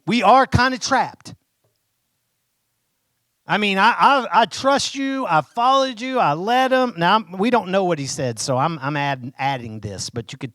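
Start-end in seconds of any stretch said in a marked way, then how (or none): 0.0-6.9 higher, louder, anxious appeal; worried Moses. Retold or imitated. Preacher voicing Moses pleading nervously with God, higher and louder. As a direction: plead your case to God, a little rattled. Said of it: "Hey, you know, Lord".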